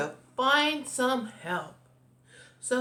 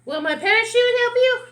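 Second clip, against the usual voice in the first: high, loud cartoon bird; shrieky, forceful bird character; 12 semitones higher, 10 dB louder than usual. A narrator's reading. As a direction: play an excitable bird, pitched way up. Bird explains its fear and remedy.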